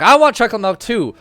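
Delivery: high, loud, eager whine; excited, loud me-too cry; childlike eager demand. Acted. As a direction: clamour for a treat like a kid. [name] clamours for chocolate milk.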